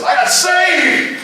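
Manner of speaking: high, slow, drawn-out cry of joy; ecstatic friend. Retold or imitated. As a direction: stretch it out like he can't contain himself. Friend bursting in, just saved.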